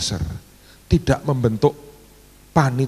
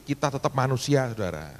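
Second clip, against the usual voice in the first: ordinary talk, nothing marked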